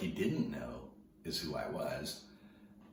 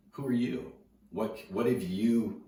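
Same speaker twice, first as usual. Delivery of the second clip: confronting; 7 semitones higher, 8 dB louder, slower than usual. Retold or imitated. Surfer confronting the kid.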